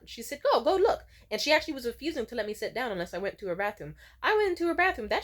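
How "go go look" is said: higher, louder imitation of a bossy order; mock insistent urging. Stylist sending client to her bathroom, mock insistent. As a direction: push it like an impatient dare.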